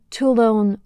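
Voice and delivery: first Toulon said in English accent, "too-lon" with a full n, no nasal. Shown as a demonstration. Teacher contrasting the film actors' Toulon, English accent. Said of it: "avec un accent anglais".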